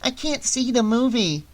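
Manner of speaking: put-on high little-girl protest; complaining child. A narrator's reading. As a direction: complain like a small kid. Child complains at blocked screen.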